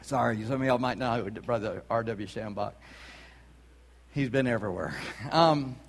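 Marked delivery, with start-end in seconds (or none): none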